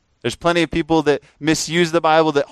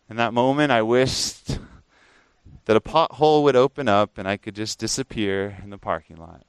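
ordinary talk, nothing marked

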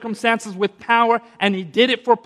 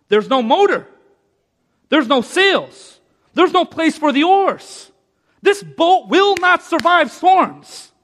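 building preaching; 9 semitones higher, 6 dB louder, slower than usual. Acted.

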